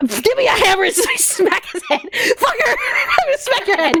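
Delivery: high laughing threats; laughing outburst. Real, not acted. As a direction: laugh while yelling threats. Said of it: "laughing outburst at character".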